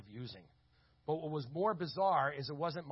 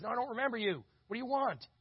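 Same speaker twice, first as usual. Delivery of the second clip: gruff; 8 semitones higher, 3 dB louder than usual. Retold or imitated. Grumpy Tony at the door.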